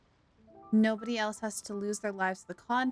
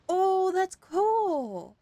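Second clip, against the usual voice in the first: drawn-out, high, loud delight; impressed reaction; 9 semitones higher, 6 dB louder, much slower than usual. Real, not acted. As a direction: stretch it out, really impressed. Streamer impressed by game detail.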